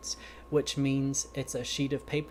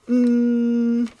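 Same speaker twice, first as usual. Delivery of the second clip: long, high, loud "mmm"; demonstrated hum; 9 semitones higher, 13 dB louder than usual. Acted. Pig copies Goat's mm sound, high steady hum. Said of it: "You mean like this?".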